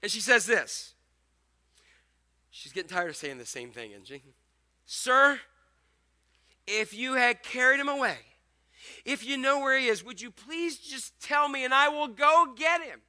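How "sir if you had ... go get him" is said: urgent, louder plea; pleading. Retold or imitated. Mary pleading with the gardener, louder and urgent. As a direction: beg him to tell you.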